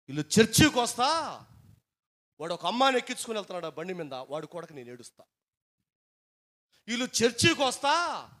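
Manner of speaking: loud, high impassioned prayer; a pastor crying out to God for his flock; fervent preaching. Acted. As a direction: pour out the burden at full force. Preaching at full force.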